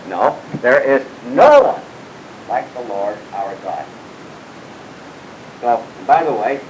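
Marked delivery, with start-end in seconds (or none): 0.1-3.8 refute the idea, stressing no; emphatic denial. Shown as a demonstration. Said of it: "There may be someone elsewhere".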